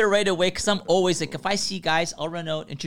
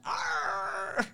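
sudden high yelp, much higher, as if grit hit the eye; mock pained yell; 8 dB quieter than usual. Worker gets blown-in in his eye, mock pained yell. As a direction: cry out like something just flew in your eye.

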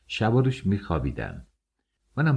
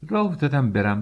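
usual speaking voice

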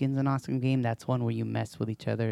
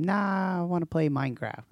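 put-on kid's whiny refusal; 6 semitones higher than usual. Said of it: "I'm like".

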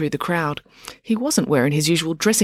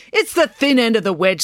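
ominous; 6 semitones higher, 4 dB louder than usual. Acted. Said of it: "said April ominously".

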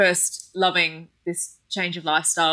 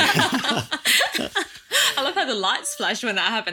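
laughing; 5 semitones higher, slower than usual. Real, not acted. Reacting to weird noise.

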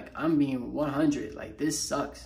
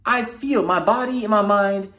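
higher and louder, real frustration building to the edge of a yell; close to yelling; 4 semitones higher, 4 dB louder than usual. Real, not acted. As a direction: let the real frustration push the voice up until it almost breaks into a yell. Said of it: "I was about to just start yelling".

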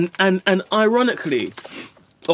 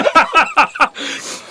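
high, louder burst of laughter; high real laugh; 11 semitones higher, 4 dB louder than usual. Real, not acted. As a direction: break into a squeaky laugh. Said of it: "I shouldn't laugh".

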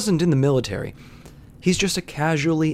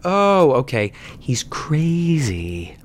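dawning realization; 3 semitones higher, much slower than usual. Retold or imitated. Bartender realizing the customer is crazy, slow dawning. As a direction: let it sink in slowly.

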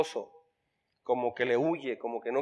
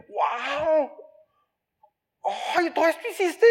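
a high, delighted gasp of wonder; amazed husband; 8 semitones higher, slower than usual. Retold or imitated. Husband coming home to a spotless house, amazed.

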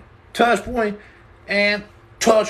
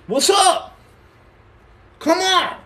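hyped call-out; 11 semitones higher, slower than usual. Real, not acted. Hyped up calling out to chat.

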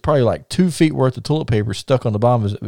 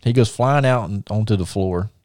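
plain reading voice

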